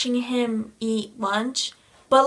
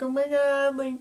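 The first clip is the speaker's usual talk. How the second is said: squealing high, fangirl excitement; can barely hold it in, voice up high; star-struck fan squeal; freaking out; 8 semitones higher than usual. Retold or imitated. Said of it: "I'm freaking out".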